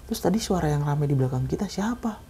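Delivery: soft, uneasy voice asking about ghosts; hushed scared question. Retold or imitated. Friend asks the narrator if the noise was a ghost, hushed and scared. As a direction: say it quietly so the spirits won't hear.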